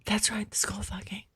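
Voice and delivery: breathy whisper, half laughing; whispered aside. Real, not acted. Guest whispering the phrase back to the host. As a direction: say it under your breath.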